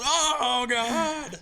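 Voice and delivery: high, drawn-out collective groan; mock group groan. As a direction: imitate a whole room crying out. Everybody's groan at the flower prank.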